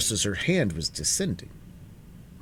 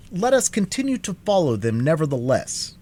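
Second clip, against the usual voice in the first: raised, emphatic character voice; determined Scarecrow; 7 semitones higher, 5 dB louder than usual. A narrator's reading. Scarecrow urging the party to keep following, determined. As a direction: say it high and loud, resolute. Scarecrow figure speaks in narration.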